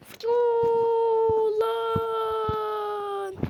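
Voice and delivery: long dragged alarm; drawn-out wail. Real, not acted. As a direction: stretch every syllable out.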